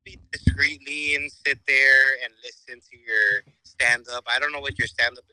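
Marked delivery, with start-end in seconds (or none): none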